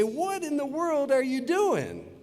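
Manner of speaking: high, slow, drawn-out disbelief; shocked parents. Acted. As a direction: exclaim it high like an astonished parent. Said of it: "her parents come in and say".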